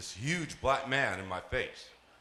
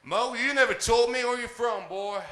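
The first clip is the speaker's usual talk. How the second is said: Texas sergeant; 14 semitones higher, 6 dB louder than usual. Retold or imitated. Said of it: "he's like".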